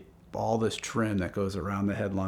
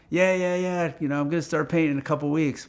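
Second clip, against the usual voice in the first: higher, hurried retold reassurance; brushing off; 6 semitones higher, 3 dB louder than usual. Painter putting off the owner who calls, brushing off. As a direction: wave the worry away quickly.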